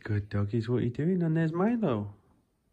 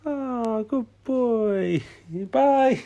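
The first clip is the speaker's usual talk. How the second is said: high, loud squeal of praise; excited praise; 9 semitones higher, 10 dB louder, much slower than usual. Real, not acted. Praising the dog gently sniffing the baby, loud high squeal. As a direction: gush over the dog.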